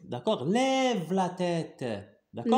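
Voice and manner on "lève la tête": "Lève la tête" stretched out word by word; slow reading model. Shown as a demonstration. Teacher modelling a phrase for pupils, slow and drawn out. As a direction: draw out "Lève la tête" slowly for repeating.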